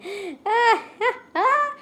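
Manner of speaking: high, half-crying laugh; uneasy high laugh. Real, not acted. Streamer recalling a frightening drawing, uneasy laugh. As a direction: laugh high, close to a whimper.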